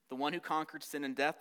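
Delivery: ordinary talk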